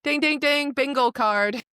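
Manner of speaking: high, loud bingo call; playful, high, loud announcement; mock game-show bell. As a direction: ring it out like a winner's bell.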